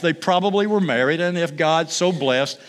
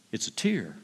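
quiet; 6 semitones lower, 9 dB quieter than usual. Acted. God reveals the tear.

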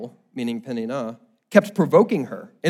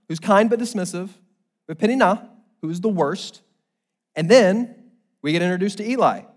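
ordinary talk

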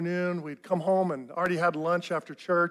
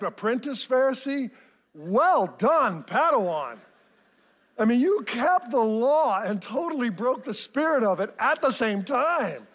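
ordinary talk

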